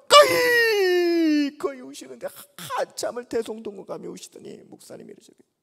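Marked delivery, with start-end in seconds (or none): 0.1-1.8 high, loud, slow wail; wailing sob. Retold or imitated. Old pastor wailing uncontrollably. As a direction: let out a long sobbing wail. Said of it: "정말 목을 놓아가지고".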